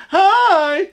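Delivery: very high, effusive mock hello; mock best-friend greeting. Lapsed clients act like best friends, mock gushing. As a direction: squeal hi like a fake best friend. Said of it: "like they're, you know, best friends".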